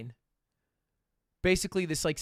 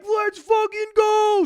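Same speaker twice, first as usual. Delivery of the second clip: much higher, much louder, slow hyped shout; thrilled shout; 9 dB louder, slower than usual. Retold or imitated. Told the DJ returns, drawn-out thrilled shout. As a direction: yell it like your team just scored.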